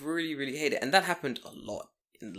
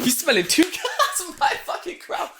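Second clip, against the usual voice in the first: laughing mock neighbour; 5 dB louder than usual.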